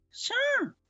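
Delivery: very high, bright quick answer; high mother voice. Acted. Mother agreeing to bake the gift, in a very high voice. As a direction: chirp it like an easygoing mom.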